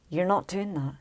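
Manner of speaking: ordinary talk, nothing marked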